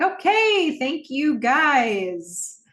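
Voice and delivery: high, loud, drawn-out fake-cheerful thanks; sugary thanks with a pasted-on smile; forced polite thanks. Cam thanking the boys while faking a smile, forced sweetness. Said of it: "but it's not really a smile".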